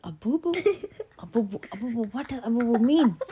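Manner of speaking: high, questioning monster voice, laughing; puzzled monster. Acted. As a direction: repeat it high in bafflement, breaking into a laugh. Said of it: "And the monster says".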